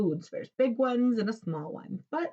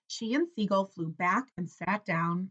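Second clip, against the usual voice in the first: usual speaking voice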